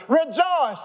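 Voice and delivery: high, loud cry of rejoice; joyful shout. Acted. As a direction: call it out to the congregation. Preacher shouts the verse.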